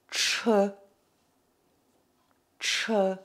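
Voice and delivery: "tche" as retroflex ch plus French eu; curled-tongue tche. Shown as a demonstration. Teacher demonstrates for learners, drawn-out "tche" with tongue back. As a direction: model "tche" twice, separately. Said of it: "Le C E".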